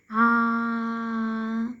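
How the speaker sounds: "Ah" held long on one steady note; voiced ah. Shown as a demonstration. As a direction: sustain "Ah" so the throat vibrates. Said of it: "those of vocal voice vibrate".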